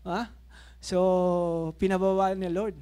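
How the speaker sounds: ordinary talk, nothing marked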